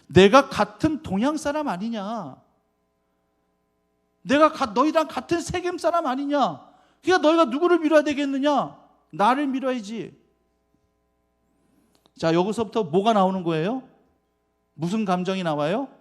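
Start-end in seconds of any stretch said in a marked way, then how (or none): 0.1-10.2 higher, louder, pushy campaign plea; rally the crowd to back you; Abimelech pleading. Retold or imitated. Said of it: "그가 고백하고 있는 것이 뭐냐면은". (높고 큰 소리로 자기를 밀어달라는 호소)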